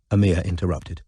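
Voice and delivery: plain reading voice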